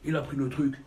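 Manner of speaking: ordinary delivery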